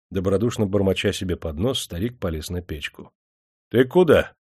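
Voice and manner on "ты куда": sharp, higher-pitched challenge; stern call. A narrator's reading. Major stops the old man climbing onto the stove, sternly. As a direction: call him back sternly. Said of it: "строго окрикнул его майор".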